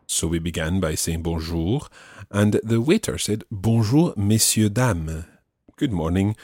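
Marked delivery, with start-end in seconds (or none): none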